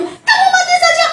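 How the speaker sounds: very high squawked curse; parrot squawk. Acted. Parrot slips its curse between prayers, squawking high. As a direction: squeal it out like a bird. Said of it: "Burung beo itu".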